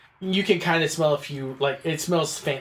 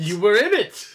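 higher, louder burst of disbelief; exasperated outburst; 8 semitones higher, 7 dB louder than usual. Acted. Servant insisting he was in the dungeon, exasperated outburst. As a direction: throw it back at him, loud and incredulous.

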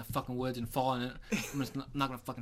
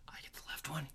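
hushed whisper while aiming at deer; 4 semitones higher, 8 dB quieter than usual. Retold or imitated. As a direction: whisper the plan so the bucks don't hear. Two hunters planning a shot.

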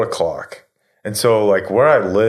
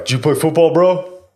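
higher pitched, rising into a goofy bro voice; mock stranger voice; 8 semitones higher than usual. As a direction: imitate the odd stranger, pitch jumping up on bro. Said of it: "And the guy was like".

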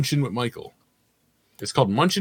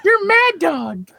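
very high, drawn-out mock ad voice; old Sprite commercial voice; 5 dB louder, slower than usual. Imitating an old Sprite commercial for the co-host, silly high voice. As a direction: squeal it slowly like a silly commercial character. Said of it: "that old Sprite commercial".

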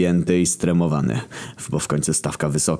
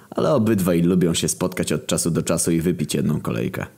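usual speaking voice

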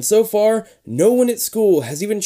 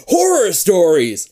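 "Horror stories" louder, slow and exclaimed; 4 semitones higher, 5 dB louder, slower than usual. Shown as a demonstration. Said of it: "Nick exclaims".

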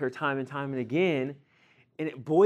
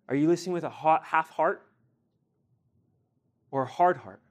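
usual speaking voice

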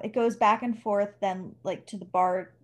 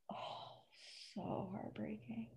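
voiceless, very soft whisper; barely-there sighed whisper; heartbroken whisper; 23 dB quieter, slower than usual. Real, not acted. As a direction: whisper it as if overcome. Overcome by the book's sad ending.

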